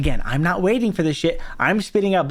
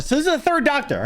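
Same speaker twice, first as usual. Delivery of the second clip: ordinary delivery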